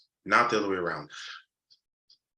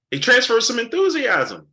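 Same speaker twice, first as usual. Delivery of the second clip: excited exclaim; 13 semitones higher, 9 dB louder than usual. Real, not acted. Excited punchline after laughing.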